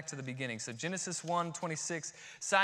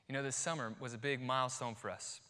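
ordinary talk

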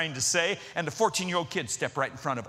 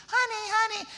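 very high, tiny and sweet; faster than usual. Retold or imitated. Big guy coos to his wife, tiny high voice. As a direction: squeak it like a tiny big man. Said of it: "I hear this little tiny voice".

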